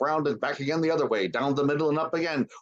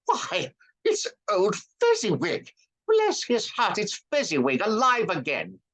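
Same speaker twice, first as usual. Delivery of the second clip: high, slowed, thrilled cry; overjoyed at seeing an old friend; great excitement; 11 semitones higher than usual. A narrator's reading. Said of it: "Scrooge cried in great excitement".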